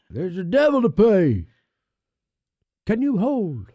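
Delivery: high, stretched-out hammy film quote; Sam Elliott-Sling Blade mashup. Acted. Host quoting a Gettysburg film, hammed-up high impression. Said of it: "doing a Sam Elliott or Sling Blade".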